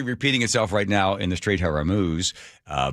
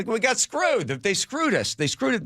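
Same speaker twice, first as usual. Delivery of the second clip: much higher, aggrieved mock complaint; hammed-up outraged grumble, pitched way up; indignant whine; 13 semitones higher than usual. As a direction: squeal it like a cheated buyer. Turks complain after losing ships.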